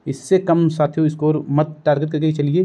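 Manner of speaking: ordinary delivery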